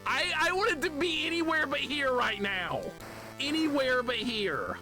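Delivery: high, wailing complaint; desperate exasperation. Real, not acted. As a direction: wail that you've had enough. Desperate to quit the game.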